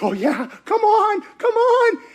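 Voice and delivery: high, loud excited urging; eager hope. Retold or imitated. Peter hoping Pilate frees Jesus, high and eager. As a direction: cheer it on under your breath, hopeful.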